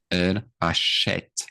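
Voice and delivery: slow elle achète, ACHÈTE with an open "eh". Shown as a demonstration. French teacher letting students hear the accent, clearly pronounced achète. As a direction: say achète ending in a clear "esh-t". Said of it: "you can hear it too".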